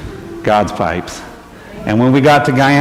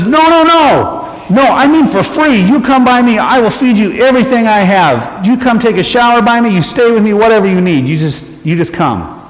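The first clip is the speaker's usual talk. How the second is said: insistent shopkeeper; 8 semitones higher than usual. Retold or imitated. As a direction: press the offer eagerly. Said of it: "And he says".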